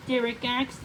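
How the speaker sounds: ordinary delivery, nothing marked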